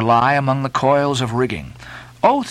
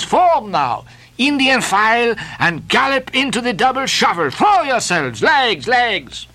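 higher, boisterous call to dance; rowdy dancing sailor; 11 semitones higher than usual. Acted. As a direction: whip the crew into a jig.

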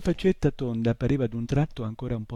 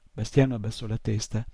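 ordinary delivery

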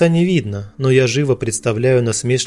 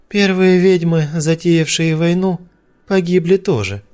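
smirking; 5 semitones higher than usual. A narrator's reading. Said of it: "усмехается Аза".

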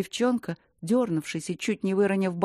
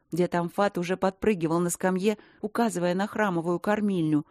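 ordinary delivery, nothing marked

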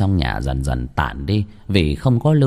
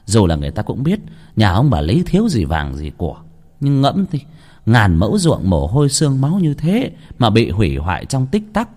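plain reading voice

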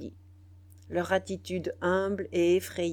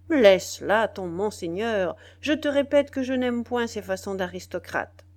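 irritated rebuke; 2 semitones higher, 6 dB louder than usual. Acted. Biassou rejecting the title, loud and irritated. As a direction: cut him off sharply.

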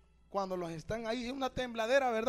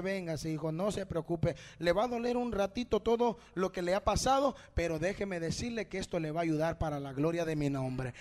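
lower, gentle fatherly voice; tender reassurance; 4 semitones lower than usual. Acted. Preacher voices God comforting a hurt son, reassuring. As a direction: soothe the child, bringing the pitch down.

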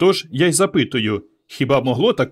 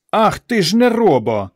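raised, louder exclamation of anger; angry outburst; 7 semitones higher, 5 dB louder than usual. A narrator's reading. Mother losing her temper at her idle son, angry. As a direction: burst out, unable to hold the anger in. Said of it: "не стримала мати гніву".

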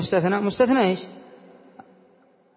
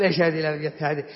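quick, softer, lower mock student complaint; imitating a student; 7 semitones lower, 5 dB quieter, faster than usual. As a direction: mimic a baffled student scoffing. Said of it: "بعضهم يضحك كأنه يقول". (تقليد طالب متذمر بصوت أخفض وأسرع)

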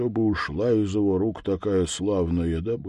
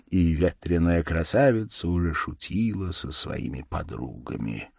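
plain reading voice

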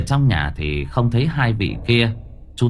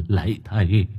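put-on terrified old ghost, pleading, climbing higher into a whine; a frightened spirit pleads, mildly whimpering; 6 semitones higher than usual. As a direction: beg the exorcist, whimpering and rising as the fear builds. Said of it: "sợ mất mật mếu máo".

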